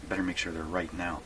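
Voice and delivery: ordinary delivery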